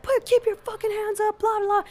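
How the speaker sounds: very high mock cop yell; high-pitched mock rant; retold yelling. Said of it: "they're still yelling".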